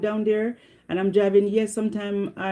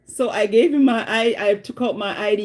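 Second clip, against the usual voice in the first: usual speaking voice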